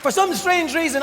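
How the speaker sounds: ordinary delivery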